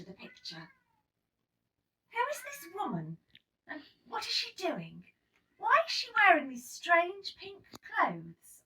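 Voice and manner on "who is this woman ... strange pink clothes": put-on higher, wondering child's questions; curious child. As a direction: ask like a puzzled little girl. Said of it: "I asked Teacher Sarah".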